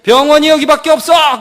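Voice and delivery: indignant mock complaint; huffy patient. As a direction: snap it like an offended patient. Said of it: "환자들 이러잖아요".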